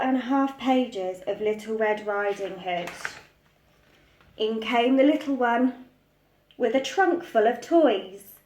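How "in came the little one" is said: ordinary delivery, nothing marked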